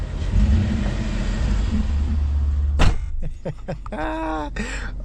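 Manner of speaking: long squealing laugh of delight; excited high laugh. Real, not acted. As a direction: squeal with joy. Excited about new exhaust.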